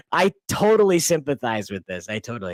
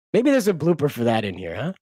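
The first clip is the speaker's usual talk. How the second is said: ordinary delivery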